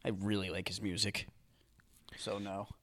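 hushed whisper. Real, not acted. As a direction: whisper it like a secret. Whispered confession.

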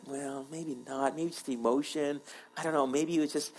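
soft, hesitant second-guessing; low-key, tentative musing; creeping doubt. Acted. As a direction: voice a doubt quietly to yourself. Voicing creeping doubt after Sunday.

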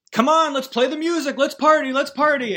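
high, loud whooping party cheer; excited party call. Retold or imitated. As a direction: hype the room up to party. Party starting at one million.

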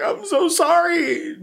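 high, slow, hammed-up wail; mock-sob the apology way up high; crying apology. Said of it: "crying and shit".

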